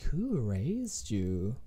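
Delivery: slow, drawn-out scorn; slow disdainful question. Retold or imitated. To rude customers, a slow scornful question. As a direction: stretch each word in disbelief. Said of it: "I'm like".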